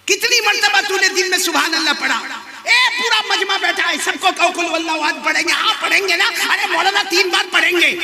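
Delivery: high, hectoring sermon voice; fiery preaching. Real, not acted. Preacher accusing the assembled crowd, fiery. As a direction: challenge the whole gathering, building to a shout.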